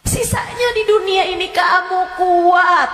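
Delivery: loud, high, shouting warning; fiery preaching. Acted. Ustazah warning her listeners of torment in the grave, shouting.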